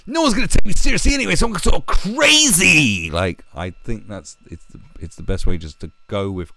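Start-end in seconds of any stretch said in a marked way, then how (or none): 0.1-3.1 high, loud hyped DJ shout; crazy radio DJ. Retold or imitated. Mimicking Charlie Sloth's hype, high and loud. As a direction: ham up a wild hip hop DJ, fast then slowing. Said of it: "he sort of carried the torch on and just".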